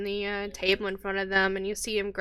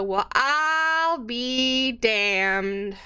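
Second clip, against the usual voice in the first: slow, drawn-out barmaid voice; [name] drawling; 4 semitones higher, much slower than usual. Acted. Barmaid reacts to lightning news, slow and drawn out. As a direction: savor each word slowly.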